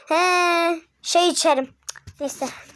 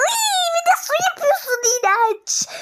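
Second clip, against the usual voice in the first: much higher, whiny cry of disgust; disgusted squeal; 14 semitones higher than usual. Real, not acted. To her partner pouring water on the pan, disgusted whine. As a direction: squeal that it's gross.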